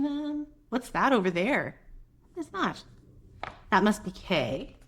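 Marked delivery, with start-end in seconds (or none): none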